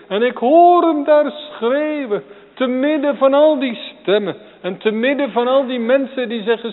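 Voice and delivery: high and loud, scornful crowd voices inside; fervent preaching. Acted. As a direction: preach the mocking crowd with force. Voicing crowd silencing Bartimaeus.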